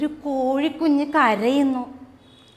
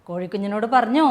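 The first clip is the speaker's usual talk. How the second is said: plain reading voice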